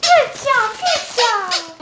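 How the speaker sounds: high, loud cheering praise; excited praise. Real, not acted. Celebrating the million-subscriber plaque, loud high cheering. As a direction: cheer yourself on.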